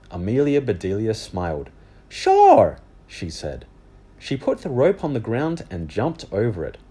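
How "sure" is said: put-on high girl voice. Amelia agrees.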